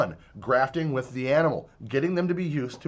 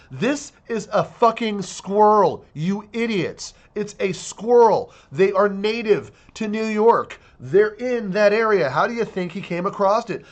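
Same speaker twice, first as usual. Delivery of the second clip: slow, loud, exasperated; real frustrated outburst at bureaucrats; exasperated anger; 4 semitones higher, 4 dB louder, slower than usual. Real, not acted. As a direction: spell it out to the idiots. Ranting at department officials.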